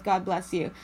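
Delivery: ordinary delivery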